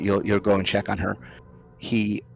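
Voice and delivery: usual speaking voice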